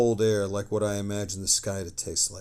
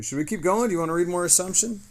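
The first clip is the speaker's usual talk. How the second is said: higher and louder, eager to keep reading; excited asking; 10 semitones higher, 6 dB louder, faster than usual. Real, not acted. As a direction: pitch the question up with real excitement. Eagerly asking chat to continue.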